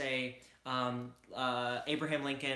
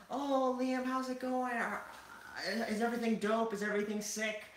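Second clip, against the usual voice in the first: much higher, far-off goofy voice; Oliver impression; 9 semitones higher than usual. Imitating Oliver greeting Liam, much higher. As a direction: squeak it out like an overexcited dude. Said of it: "just laughing and saying things like that".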